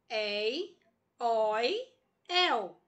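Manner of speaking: "Ei, oi, el" with wide-open vowels, one at a time; open diphthongs. Shown as a demonstration. Teacher naming open diphthongs to students, "Ei, oi, el" with open vowels. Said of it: "Quem são os ditongos abertos?".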